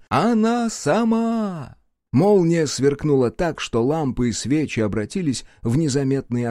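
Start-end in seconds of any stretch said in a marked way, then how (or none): 0.1-1.8 high, slow drawn-out shout; excited cry. A narrator's reading. Willie shouts that the cat came herself, excited cry. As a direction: cry out in amazement. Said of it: "закричал он".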